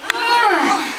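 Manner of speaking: loud, high effort yell; straining pull cry. Acted. Storyteller pulls at the turnip with children, straining cry. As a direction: heave with a big acted strain.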